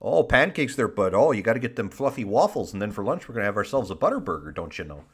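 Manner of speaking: hammed-up Wisconsin accent; put-on Midwestern patter. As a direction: play up the folksy Midwestern vowels. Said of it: "challenging me to a Midwestern off".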